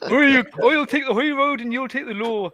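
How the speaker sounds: sing-song comic Scottish accent. Shown as a demonstration. Shows friends a Scottish accent with an old song line, Scottish accent.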